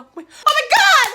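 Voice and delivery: high, loud gleeful shriek; hammed-up overjoyed yelling; ecstatic recognition. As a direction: cry out in high-pitched amazed joy. Retold shock at recognizing street.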